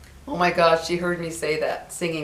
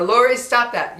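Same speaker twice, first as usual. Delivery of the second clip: put-on stern, louder scolding of a child; sharp warning to a naughty girl; a neighbor telling off young Lori; 6 semitones higher, 6 dB louder than usual.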